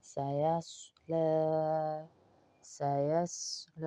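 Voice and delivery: سیصلی with seen and saad kept apart; distinct saad. Shown as a demonstration. Teacher contrasts seen and saad slowly. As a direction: separate seen and heavy saad. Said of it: "صاد کی آواز الگ ہوگی".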